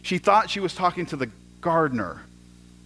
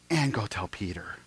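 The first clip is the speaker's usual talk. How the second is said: breathy whisper, much softer; 8 semitones lower, 9 dB quieter than usual. Acted. Jesus tenderly singling out the despairing Peter, whispered. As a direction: lean in and whisper it like a secret.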